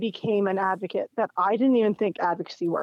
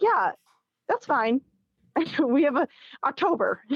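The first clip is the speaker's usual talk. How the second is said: mocking an offhand clerk, pitched higher; mock breezy receptionist; 5 semitones higher than usual. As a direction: airily brush off the urgency with a laugh. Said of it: "they're like".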